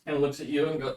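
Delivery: usual speaking voice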